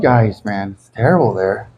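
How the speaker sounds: normal talking voice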